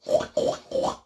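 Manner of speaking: snorted, throaty pig oinks; almost swallow the oink. Shown as a demonstration. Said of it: "another way where you almost swallow".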